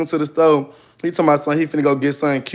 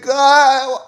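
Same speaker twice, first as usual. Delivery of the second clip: shocked cry; 10 semitones higher, 5 dB louder than usual. Retold or imitated. Retold shock.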